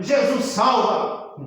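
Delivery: high, drawn-out proclamation; crying out. Acted. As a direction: cry it out slowly as a preaching stone. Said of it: "as pedras gritando".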